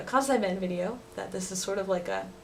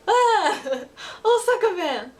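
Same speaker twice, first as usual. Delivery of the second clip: laughing surprise; 10 semitones higher, 5 dB louder, slower than usual. Real, not acted. Amused reaction to kids.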